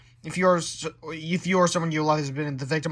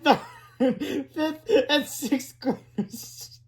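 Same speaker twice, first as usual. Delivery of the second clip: laughing while reading; 10 semitones higher, 4 dB louder, slower than usual. Real, not acted. Narrator cracking up.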